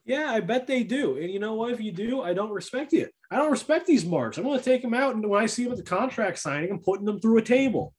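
high-pitched wrestling-promo threat; hammed-up high indignation; mock-indignant rant. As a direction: rant it like a wrestler calling out foes. Mock wrestling threat against the marks.

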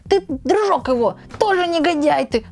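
high, scolding nag; a shrill nagging wife; shrill nagging grandma. Retold or imitated. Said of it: "говорит". (визгливое ворчание)

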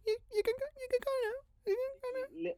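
very high, tiny squeaky voice; runner impression. Host copying the guest's impression of the runner, high squeak. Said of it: "I quite like that impression of".

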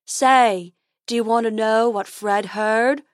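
dopey, drawn-out creature voice; silly unicorn. A narrator's reading. Unicorn offers Dentina gossip, silly character voice.

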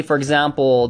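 ordinary talk

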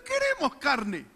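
high, slow whining plea; whiny complaint. Retold or imitated. Preacher imitating Israelites demanding meat, slow high whine. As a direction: drag it out like a sulky crowd. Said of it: "Y ellos diciendo".

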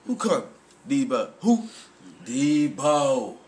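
usual speaking voice

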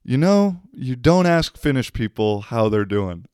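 high, knowing smiling warning; knowing local woman. Retold or imitated. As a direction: tease with a knowing smile. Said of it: "She goes".

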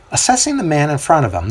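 ordinary delivery, nothing marked

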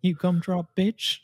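high, slow cartoon taunt; squeaky mock insult. Gingerbread fight roleplay.

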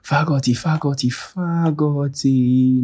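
high taunting chant; high mocking singsong.

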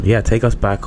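ordinary talk, nothing marked

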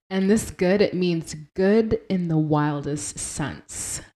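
normal talking voice

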